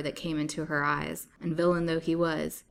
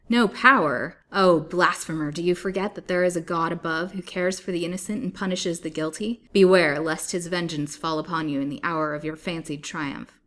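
loud, impassioned warning; righteous denunciation; 8 dB louder than usual. A narrator's reading. Captive warns villain of God's vengeance, impassioned. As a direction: denounce him, ringing out like a prophetess.

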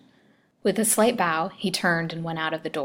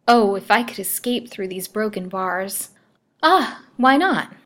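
yearning wish lifting into sudden resolve; dawning hope; 4 semitones higher, 5 dB louder than usual. A narrator's reading. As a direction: let the pitch leap as the idea strikes. Sudden wild hope of escape.

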